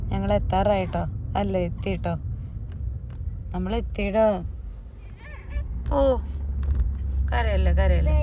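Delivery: calm the little one down softly; soothing. Real, not acted. Soothing crying child.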